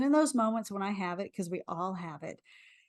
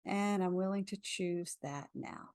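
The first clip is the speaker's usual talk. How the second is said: guided affirmation. Acted. Leads a tapping affirmation.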